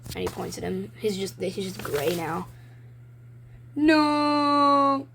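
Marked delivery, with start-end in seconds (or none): 3.8-5.1 higher, louder anguished no; a dramatic cartoon howl of loss; despairing cry. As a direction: wail it like a ruined planet. Gray Earth despairing in comic.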